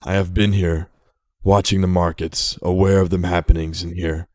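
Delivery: ancient dragon speaking. Acted. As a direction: boom a little as the ancient gold dragon.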